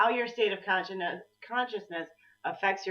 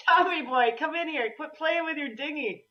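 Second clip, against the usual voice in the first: much higher calling voice, a loosely voiced parent from the film; movie quote voice; 9 semitones higher, 3 dB louder than usual. Quoting a movie to her co-host, calling out Tommy boy. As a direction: call him in from the other room, playful. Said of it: "Remember that movie?".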